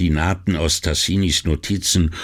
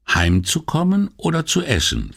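higher, wry wife's voice; teasing wife; 11 semitones higher than usual. Acted. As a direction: needle him gently as his wife. Wife's pointed question. (Ehefrau neckt ihren Mann, höhere Stimme)

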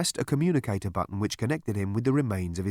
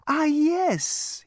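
much higher, sing-song soothing voice; chirpy psychiatrist. A narrator's reading. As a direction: purr reassurance to a violent patient.

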